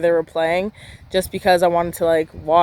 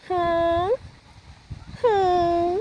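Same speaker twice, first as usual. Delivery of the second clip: soft, high "hmm"; high-pitched hum; 10 semitones higher, 6 dB quieter than usual. Real, not acted. Younger sister hinting at brother's cute friends, high playful hum. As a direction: hum suggestively, held on a high note.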